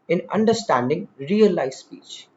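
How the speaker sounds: ordinary talk, nothing marked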